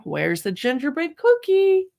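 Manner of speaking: enthusiastic read-aloud. Shown as a demonstration. Reading a board book to a baby, slow eager question. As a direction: tease the baby to look for it. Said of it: "read it with an enthusiastic tone of voice".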